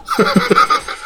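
sudden squeaky laugh; high burst of laughter. Real, not acted. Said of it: "어?".

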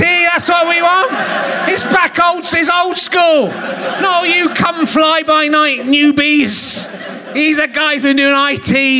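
hammed-up rallying cry; excited shout. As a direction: cheer him on like a proud fan. Excited praise of the IT man.

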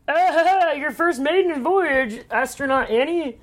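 high, slow put-on voice of a smug older man; Drake voice. Friend imitating Drake welcoming Annie, hammed-up. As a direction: ham it up as the grand Drake greeting her. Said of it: "That's a great Drake voice".

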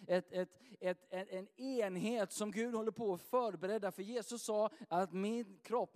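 high, building preacher's fervour, getting carried away; fired-up preaching. Real, not acted. A pastor so worked up he must tell himself to calm down, fervent. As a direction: preach it at full force until you have to rein yourself in. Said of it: "Jag får lugna ner mig lite".

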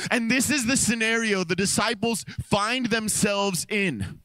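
rising preacher cadence, building lists; preaching. Acted. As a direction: preach it, let each storm hit land.

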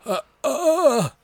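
high, far-off voice, stunned stammer; shocked stammer. Retold or imitated. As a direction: gasp out a horrified stammer. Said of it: "like, shocked in horror".

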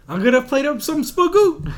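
high, loud put-on cartoon voice. Podcaster quoting a cartoon, high loud cartoon voice. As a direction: squeak it out like a cartoon kid. Quoting South Park in character.

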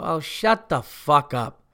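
slow, drawn-out retold put-down; fed-up comeback, mildly acted; exasperated retort. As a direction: retell an exasperated brush-off, dragging the words. Retold curt comeback to yelling women.

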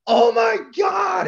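high, loud, slow panicked cry. Retold or imitated. Coach sees the boy knocked out, panicked high shout. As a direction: cry out in horror at the fallen boy.

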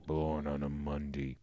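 low, quiet monster grumble; a low grumbled zombie mutter; Grundy grumbling. Retold or imitated. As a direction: grumble it like a brute shuffling off. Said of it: "walks away grumbling".

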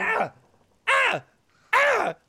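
very high, loud shrieking squawks; bird shrieks. Acted. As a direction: squawk in alarm like a big bird. Kenku shrieks as kid escapes.

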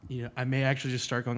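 ordinary talk

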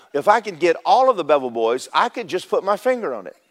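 normal talking voice

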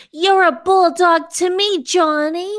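hammed-up reading. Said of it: "read the fan fiction".

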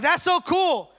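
high, loud, delighted gush; thrilled. Acted. Jesus gushing to the Holy Spirit, high and loud. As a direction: squeal like a kid who loves it.

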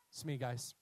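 low, soft admission; resigned confession. Acted. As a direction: own up quietly after a deep breath. Jonah confessing after deep breath.

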